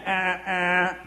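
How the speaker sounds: two sharp honk sounds; imitated horn honks. Honking again at the driver, horn imitation. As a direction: mimic a horn twice.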